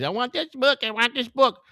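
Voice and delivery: high pleading kid voice, mild mock yell; imitating a whiny kid. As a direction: play the begging child tugging at dad. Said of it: "yelling at their daddies".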